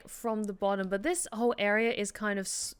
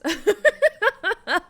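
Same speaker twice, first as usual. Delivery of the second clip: laughing; 12 semitones higher, 13 dB louder than usual. Real, not acted. Laughing after the character bit.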